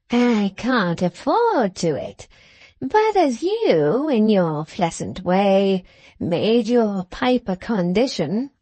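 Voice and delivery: put-on slow, drawling old man with swooping pitch; apologetic old grandfather. Old man explaining to his guest why he doesn't treat, slow and wheedling. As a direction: excuse yourself slowly as a wily old man.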